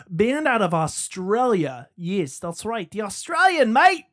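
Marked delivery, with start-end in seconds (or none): 2.0-4.0 hammed-up Australian accent, higher and louder; a bad, jokey Australian impression, punching mate; mock Australian accent. Podcast host teasing his Australian guests, mock Australian accent. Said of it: "My accents are not that good".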